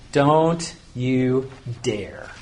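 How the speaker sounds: "Don't you dare" very slowly, word by word; weight on each word of "Don't you dare"; measured, warning "Don't ... you ... dare"; emphatic no. Shown as a demonstration. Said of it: "When you really want to emphasize".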